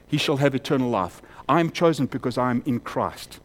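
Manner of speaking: normal talking voice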